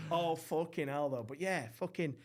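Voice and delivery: normal talking voice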